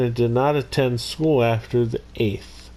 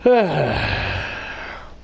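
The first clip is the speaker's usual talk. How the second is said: long sigh; 2 semitones lower than usual. Real, not acted. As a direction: let out one long breath. Pause before starting the topic.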